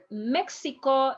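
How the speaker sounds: Mexico as "MEK-si-ko"; "Mexico" with X pronounced KS; English-style X. Shown as a demonstration. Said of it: "is going to be".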